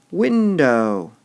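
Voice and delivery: "Window" loud, high, falling; emphasize "Window"; stress on window. Shown as a demonstration. Teacher scanning the line aloud, stressing "Window" loudly. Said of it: "We want to emphasize all those".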